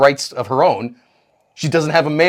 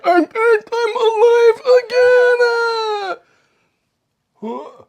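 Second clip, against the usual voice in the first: much higher, slow, whining zombie moan; hammed-up undead wail; mock zombie; 4 dB louder, much slower than usual. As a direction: drag it out like a dazed corpse. Zombie Lazarus voiced.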